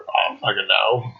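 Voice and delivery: low, offhand mock British accent. Retelling his British friend's answer, low British accent. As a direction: imitate a Brit shrugging it off, low. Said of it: "He's totally British".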